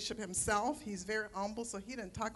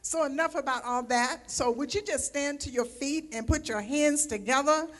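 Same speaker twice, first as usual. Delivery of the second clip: loud, high host building up the crowd; full-voice introduction of the preacher; rousing welcome; 5 semitones higher, 8 dB louder than usual. Real, not acted. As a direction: call the room to its feet. Calls church to welcome speaker.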